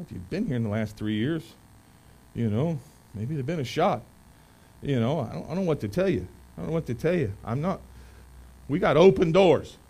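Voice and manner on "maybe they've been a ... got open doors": ordinary talk, nothing marked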